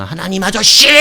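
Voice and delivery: yelling to God as uncle; hammed-up shout for attention; mock shouted plea. Worshipper calling God uncle, mock desperate shout. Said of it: "들어달라고 막".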